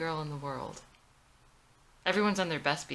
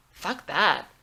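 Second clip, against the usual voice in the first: blunt dismissal; 8 dB louder than usual. Acted.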